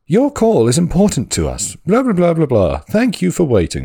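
high, bright recorded hold message; phone menu voice. Retold or imitated. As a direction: chirp it like an automated hold message. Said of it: "it's like, pre-recorded messages".

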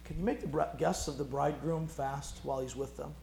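soft, gentle question; quiet, calm and tender; gentle reply. Retold or imitated. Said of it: "Jesus responds very gently".

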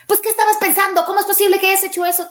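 put-on high, loud, fast scolding of a child; scolding parent. Parent scolding son over what he did, high loud reproach. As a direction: fire reproaches at the kid, voice up high. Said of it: "a acribillarlo con reclamos".

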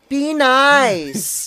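drawn-out mock reprimand; mother's scolding. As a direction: imitate a mom telling someone off. Co-host voices the scolding mother.

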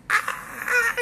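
high, loud squealing scream; wife's ecstatic scream; mock scream. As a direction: let out a hammed-up joyful shriek. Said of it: "empieza a gritar".

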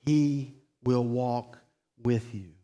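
tender sermon close. Acted. Preacher comforting a grieving congregation, gently. Closing pastoral reassurance.

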